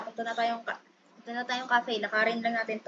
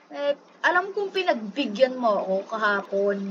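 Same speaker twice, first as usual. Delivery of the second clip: ordinary talk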